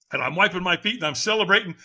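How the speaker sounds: ordinary delivery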